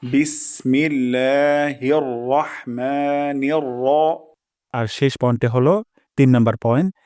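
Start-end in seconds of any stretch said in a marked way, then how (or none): 0.0-3.9 very slow, chanted বিসমিল্লাহির রহমানির; slow Quran recitation. Shown as a demonstration. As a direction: recite it slowly, stretching the vowels. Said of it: "recites the phrase". (ধীরে কুরআন তিলাওয়াত)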